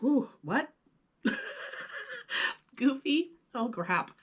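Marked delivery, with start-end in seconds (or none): 1.2-2.5 breathy high laugh at a mistake; real laugh. Real, not acted. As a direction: crack up at yourself. Reader laughs at own flub.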